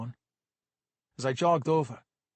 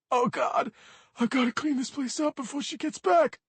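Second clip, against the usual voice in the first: put-on high, loud childish fretting; tearful panic; 13 semitones higher, 4 dB louder than usual. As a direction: panic like a kid in trouble. Sobbing kid panics about mess.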